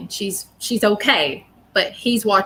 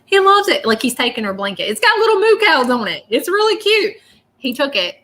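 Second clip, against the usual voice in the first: excited gushing; 5 semitones higher than usual. Real, not acted. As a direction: gush over the little blanket. Gushing over the cow blanket.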